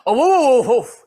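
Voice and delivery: loud woofing big dog; mock big-dog woofs; big boy dog voice. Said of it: "my big boy, dog voice".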